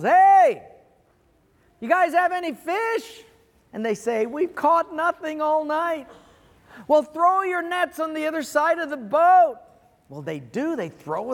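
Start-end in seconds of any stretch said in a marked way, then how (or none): 0.0-3.2 high, loud holler from shore; calling out. Retold or imitated. Jesus hails the disciples from the shore, calling out. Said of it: "he calls out to the disciples". 4.3-6.1 slow, higher-pitched answering call; calling back. Retold or imitated. Disciples answer Jesus from the boat, calling back. 6.9-9.6 high-pitched call across water. Retold or imitated. Jesus directs the fishermen from the shore, calling out.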